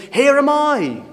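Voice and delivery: high, loud call of volunteering; eager answer. Acted. Preacher voicing Isaiah answering God's call, high and eager. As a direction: leap in, raising a hand to answer.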